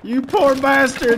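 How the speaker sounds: slow loud high wail; man down shout. Pretend. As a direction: howl in mock anguish. Mocking wounded soldier.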